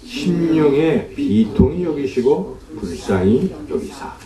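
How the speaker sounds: slow, measured verse reading; slow solemn reading of the verse; scripture reading. Acted. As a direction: read each word of scripture slowly. Reads verse thirty-three aloud.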